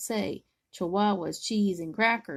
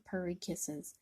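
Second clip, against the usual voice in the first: usual speaking voice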